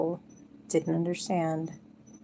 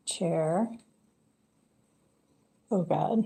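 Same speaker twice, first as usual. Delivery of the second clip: normal talking voice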